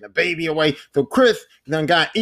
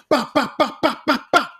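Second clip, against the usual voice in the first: rapid high "bah" bursts; sharp vocal gunfire imitation; imitated gunshots; 7 semitones higher than usual. Narrator voicing Chris shooting Mia down, rapid mock gunfire.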